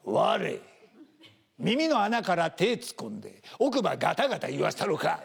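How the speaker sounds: slow, menacing Kansai gangster threat; Kansai thug threat. Retold or imitated. Kansai tough threatening someone, menacing Kansai threat. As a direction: lean in and threaten in Kansai dialect. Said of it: "向こうの怖い人たちって凄むと".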